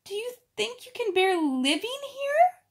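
put-on high, pleading girlish voice; a crying child who can't bear it anymore; tearful Ermengarde. A narrator's reading. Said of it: "she said".

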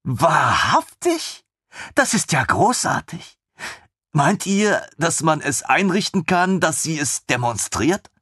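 put-on high, excited young officer; lieutenant thrilled by the idea; eager lieutenant. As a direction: bubble over with enthusiasm. Eager lieutenant voiced higher.

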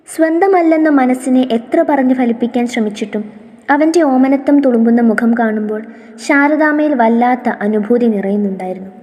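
ordinary delivery

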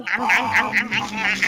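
low, soft chewing noises; munching sounds. Acted. Enjoying fried chicken in a hot bath, munching sounds.

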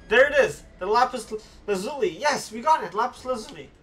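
real elated outburst; triumphant excitement. Real, not acted. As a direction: celebrate finally getting it, loud and high. Gets the item he wanted.

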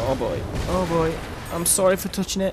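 being chased. Real, not acted. Fleeing collapsing place.

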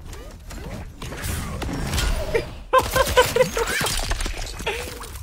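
long high, voiceless wheezy laugh. Real, not acted. Streamer cracks up during the game, long wheezy laugh.

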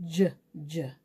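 "J" as the short sound "juh"; letter sound. Shown as a demonstration. Teacher finding J's in the book, "J" sounded out as juh. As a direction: sound out "J" as "juh", low and separate. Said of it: "sound out this letter J sound".

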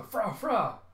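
high, loud mock dog bark. The dog Bandit barking at the vampire, high mock bark. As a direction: yap like a small robot dog. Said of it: "Bandit barking".

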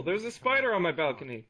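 ordinary delivery, nothing marked

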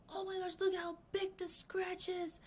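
mock horror over a tiny scratch; overreacting man. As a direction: ham up the panic over a scratch. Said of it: "So he's like".

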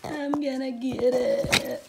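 drawn-out playful tease; slow teasing sing-song. Real, not acted. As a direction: tease the dog, slow and loud. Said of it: "repeated teasing".

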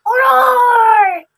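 a big loud troll roar from under the bridge. Acted. Troll bursting out at the goats, loud roar.

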